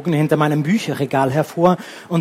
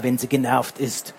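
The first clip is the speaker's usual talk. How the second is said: plain reading voice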